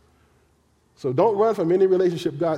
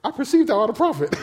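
woman amazed; 6 semitones higher, faster than usual. Retold or imitated. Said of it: "She said".

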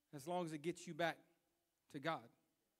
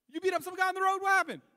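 high, loud shouted question; excited brother; 14 dB louder than usual. Retold or imitated. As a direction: yell down the phone for the whole story. Brother phones, eager for the story.

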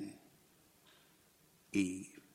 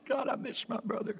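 tearful prayer; 8 semitones higher, 13 dB quieter than usual. Retold or imitated. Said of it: "I was crying".

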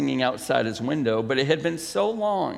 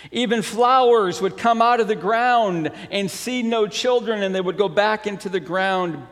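higher, animated storybook reading; story narration; 5 semitones higher, 5 dB louder than usual. A narrator's reading. Pastor reading The Selfish Giant to the congregation, lifted story narration. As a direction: read it out with lifted, emphatic storyteller energy.

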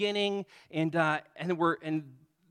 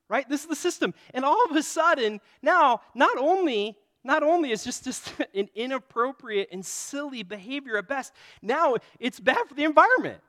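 ordinary talk, nothing marked

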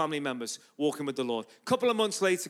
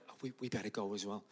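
much softer, hesitant aside; awkward mumble; 7 semitones lower, 13 dB quieter, faster than usual. Retold or imitated. Son and daughter excusing themselves to a street preacher, awkward and hushed. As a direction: imitate awkward kids, low-key and halting. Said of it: "it's a little bit awkward".

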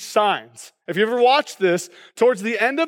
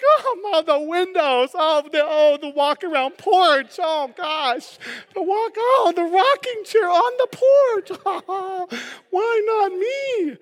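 much higher, breathless gushing over the porch; gushing viewer; 9 semitones higher than usual. Retold or imitated. Pastor acting a swooning viewer at the reveal, gushing high. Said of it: "And you're like".

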